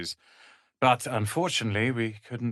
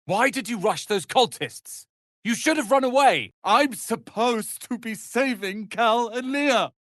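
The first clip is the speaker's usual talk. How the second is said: exasperated scolding; 11 semitones higher than usual. Acted. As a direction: scold them in frustration. Scolding an ally.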